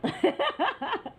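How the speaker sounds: short amused laugh; scoffing laugh; real laugh. Real, not acted. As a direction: laugh in disbelief. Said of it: "my laugh was real".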